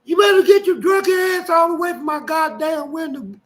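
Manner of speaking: mock furious yell at her drunk husband; hollering. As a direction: scream him off the window. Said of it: "And then she hollered".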